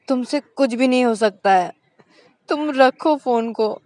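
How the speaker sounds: exasperated dismissal. A narrator's reading. Shreya dismissive.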